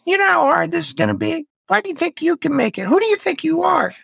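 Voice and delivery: mock whiner voice putting you down; whiny inner critic. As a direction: nag like a sneering inner whiner. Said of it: "We know how it talks to us".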